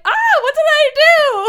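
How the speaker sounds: a confused puppy asking what it did wrong; voicing the puppy. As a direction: owner voices her dog after it got yelled at. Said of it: "Tugboat's like".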